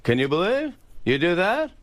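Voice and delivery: high, building Seinfeld yell; Seinfeld impression. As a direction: climb up into a Seinfeld shout. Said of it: "three different Jerrys".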